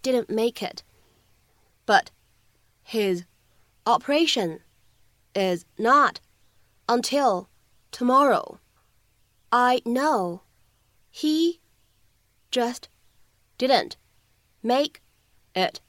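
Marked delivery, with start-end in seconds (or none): 1.9-8.6 But his operation said slowly, each word apart. Shown as a demonstration. Teacher reading But his operation one word at a time for students. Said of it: "我们需要注意哪些发音技巧呢". 9.5-15.8 he just didn't make it, each word separated by pauses; slow word by word. Shown as a demonstration. Teacher reading line slowly to class, word by word. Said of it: "我们需要注意哪些发音技巧呢".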